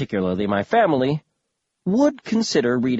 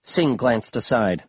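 usual speaking voice

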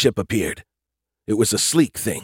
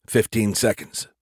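ordinary delivery, nothing marked

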